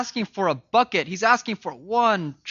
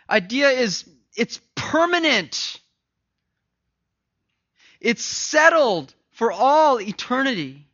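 loud, slow, higher preaching climax; full-force preaching; 7 semitones higher, 7 dB louder, slower than usual. Real, not acted. Preacher describing the great chasm to the congregation, loud and slow. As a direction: build to a thundering slow climax.

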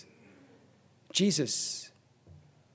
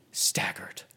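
breathed out in a whisper; 8 dB quieter than usual. Acted. Preacher quoting Lane on Jesus in the garden, whispered. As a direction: let the word collapse into a whisper.

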